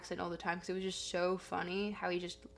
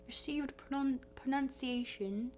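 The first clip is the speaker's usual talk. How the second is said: posh RP accent; 4 semitones higher, 4 dB quieter than usual. Shown as a demonstration. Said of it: "a bit of a received pronunciation".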